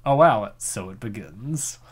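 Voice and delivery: usual speaking voice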